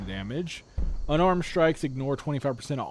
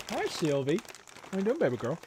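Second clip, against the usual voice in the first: pet talk; 4 semitones higher, 3 dB quieter, faster than usual. Real, not acted. Greeting the pet.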